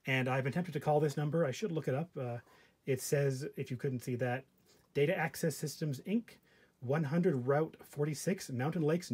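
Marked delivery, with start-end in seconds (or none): none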